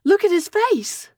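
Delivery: high, urgent shout to stop; mother yelling out in alarm. A narrator's reading. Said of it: "Jennifer yelled out".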